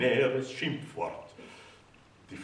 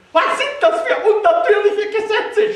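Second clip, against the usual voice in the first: shrill, much higher and louder scream; desperate scream; 14 dB louder than usual. A narrator's reading. The mother screams in despair at losing her son, very high and loud. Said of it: "schrie sie und war fürchterlich verzweifelt".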